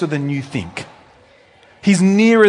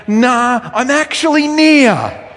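high, loud, drawn-out reply; God insisting; 9 semitones higher, 5 dB louder, slower than usual. Retold or imitated. God answering doubters that he is actually near, high and loud. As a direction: insist gently but firmly as God. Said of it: "And God keeps saying".

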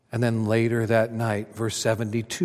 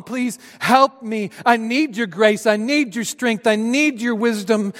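desperate plea; 9 semitones higher, 5 dB louder than usual. Pretend. Peter crying out to Jesus for grace. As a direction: beg for help with nothing left. Imagined pleading prayer of Peter.

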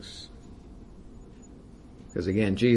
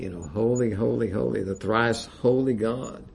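ordinary talk

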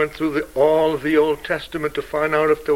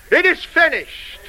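triumphant cry; 10 semitones higher than usual. Retold or imitated. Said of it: "He cried three words".

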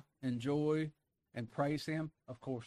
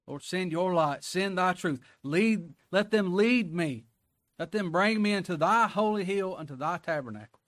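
preached prayer; 4 semitones higher, 5 dB louder than usual. Acted. Preacher praying the psalm for his flock, loud and lifted. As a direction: pray it aloud from the pulpit.

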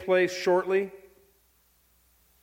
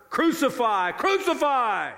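high, rising shout of an angry crowd, second cry drawn out; mob shouting louder; 9 semitones higher, 4 dB louder than usual. Retold or imitated. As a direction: cry out like a mob demanding death. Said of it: "crowd would just get louder and louder".

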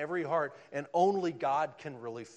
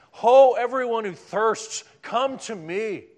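loud, slow call with higher pitch; proclaiming; 7 semitones higher, 15 dB louder, slower than usual. Acted. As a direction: call it out like a prophet. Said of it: "There's invitations in Isaiah fifty-five".

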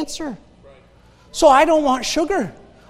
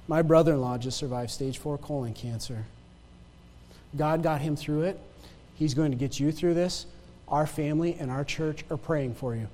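reading a note softly; 8 semitones lower, 10 dB quieter than usual. Retold or imitated.

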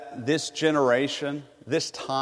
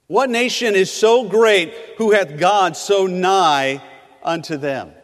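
louder, slower, emphatic repeat; forceful repeat; 5 semitones higher, 5 dB louder than usual. Acted. Preacher repeating Moses' words to the congregation, loud and emphatic. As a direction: proclaim it, leaning on so.

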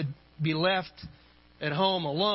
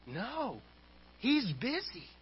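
put-on child's whisper; 3 semitones higher, 7 dB quieter than usual. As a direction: imitate a small child speaking in secret. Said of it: "came the whispered reply".

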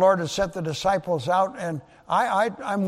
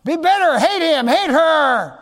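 tempting lies; 9 semitones higher, 9 dB louder than usual. Acted. Lying spirit goading a believer to hate, loud and high. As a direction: urge it loudly as an evil tempter. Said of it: "when lies come to you".

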